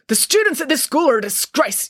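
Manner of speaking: high-pitched angry rant; angry yelling. A narrator's reading. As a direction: yell it in outrage. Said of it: "yelled Mister Pilcher".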